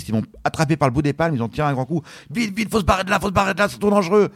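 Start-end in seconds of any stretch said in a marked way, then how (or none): 2.3-4.3 higher, hurried urging; rescuers urging. Retold or imitated. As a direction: haul him out fast. Rescuers pulling him from rocks.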